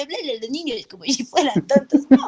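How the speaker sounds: choppy, exaggerated talk to silly kids; mock talking-down to children. Shown as a demonstration. Actress showing how not to address children, mock patronizing. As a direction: parody someone talking down to children. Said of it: "No como un bobo".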